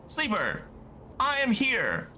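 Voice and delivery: high, airy character voice; Vendo voice. Acted. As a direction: lift it up into a floaty voice. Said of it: "It's still Vendo voice".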